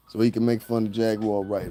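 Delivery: usual speaking voice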